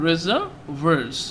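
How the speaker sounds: রিজার্ভার্স broken into parts, "reza-vers"; corrected pronunciation. Shown as a demonstration. Teacher correcting students' likely mistake, রিজার্ভার্স said in parts as "reza-vers". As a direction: split রিজার্ভার্স before its short "vers" ending. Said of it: "এটা হচ্ছে, হচ্ছে".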